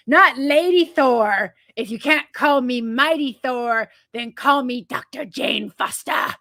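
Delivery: loud, slow, hammed-up heroic declaration; Jane Foster. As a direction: declare it like a movie hero, over the top. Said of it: "Her words, not mine".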